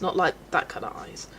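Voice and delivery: whispered joking aside; soft whispered correction. Real, not acted. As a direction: hush it as a cheeky aside.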